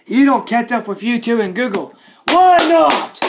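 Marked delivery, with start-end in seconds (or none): 2.3-3.2 high, loud demand; indignant yell. Acted. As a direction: yell it in outrage. Indignant rant at Facebook.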